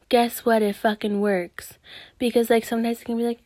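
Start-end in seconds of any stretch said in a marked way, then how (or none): none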